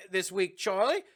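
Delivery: high-pitched mock schoolteacher questioning a pupil; teacher impression. Acts out the teacher quizzing Charlie.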